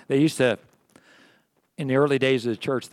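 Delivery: ordinary talk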